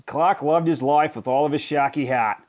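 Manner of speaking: plain reading voice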